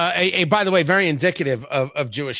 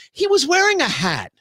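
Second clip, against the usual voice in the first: indignant mother; 8 semitones higher than usual. Acted. Mother's punchline to God.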